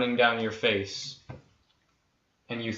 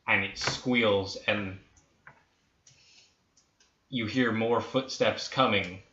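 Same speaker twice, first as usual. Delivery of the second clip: usual speaking voice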